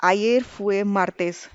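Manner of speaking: ayer fue martes spoken slowly, each word separate; slow clear model answer. Shown as a demonstration. Teacher modelling the Spanish answer, slow and clear. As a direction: spell it out slowly for learners. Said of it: "our answer would be".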